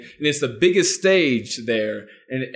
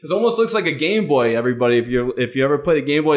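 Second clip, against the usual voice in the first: ordinary talk, nothing marked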